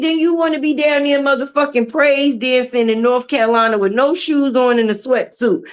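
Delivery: ordinary talk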